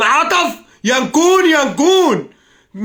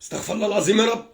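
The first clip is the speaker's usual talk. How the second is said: quiet muttered prayer; 3 semitones lower, 7 dB quieter, faster than usual. Real, not acted. Calms himself after shouting fit.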